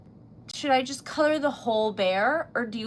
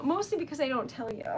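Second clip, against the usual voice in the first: normal talking voice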